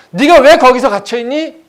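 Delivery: high, shouted rhetorical question; impassioned questioning; pointed challenge. Acted. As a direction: demand it with full voice. God questions the prisoner pointedly.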